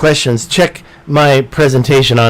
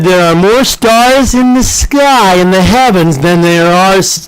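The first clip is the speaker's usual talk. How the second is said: dramatic quote; 3 semitones higher, slower than usual. Retold or imitated. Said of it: "Carl Sagan said".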